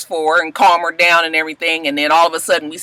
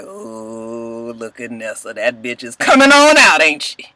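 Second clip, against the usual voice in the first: sly; 8 semitones lower, slower than usual. Real, not acted. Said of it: "I was just like".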